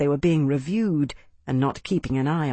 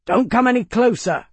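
high, loud warning call; sentry calling down a warning; 6 semitones higher, 4 dB louder than usual. Acted. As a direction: warn off a stranger from the wall. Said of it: "called the Legionare's voice".

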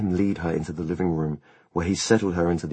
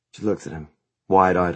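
plain reading voice

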